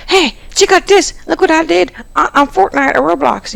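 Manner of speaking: high-pitched distracted kid; Taco. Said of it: "then he goes".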